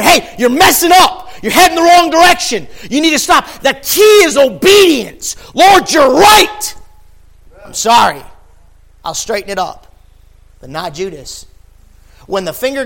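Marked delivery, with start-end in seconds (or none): 0.0-3.5 loud high shouted correction; full-force preacher shout; shouted rebuke. Acted. The Lord correcting us. 3.6-5.4 slow, loud, high preaching climax; full-force sermon shout. Real, not acted. 5.5-9.9 fervent shouted repentance; loud cried confession. Acted. Repentant reply to the Lord.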